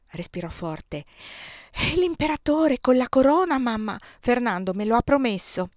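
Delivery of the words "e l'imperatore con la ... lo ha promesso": put-on high, louder child voice, breathless hope; a boy dreaming of the crown; child begging mother; eager child. A narrator's reading. Child after deep breath.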